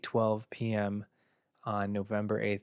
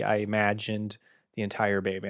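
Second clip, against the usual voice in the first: usual speaking voice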